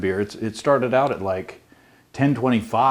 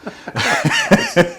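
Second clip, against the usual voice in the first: squealing high laugh; 3 dB louder than usual. Real, not acted. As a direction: burst out laughing. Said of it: "favorite [name]".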